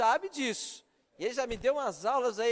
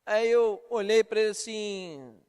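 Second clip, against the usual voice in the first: ordinary talk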